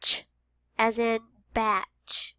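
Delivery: slow batch; slow teacher modeling; slow model word. Shown as a demonstration. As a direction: say it slowly. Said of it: "The special sound this time is".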